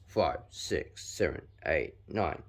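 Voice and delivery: usual speaking voice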